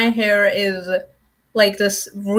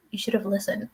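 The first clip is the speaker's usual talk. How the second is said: quiet scold; 11 dB quieter, faster than usual. Retold or imitated. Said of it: "she's like".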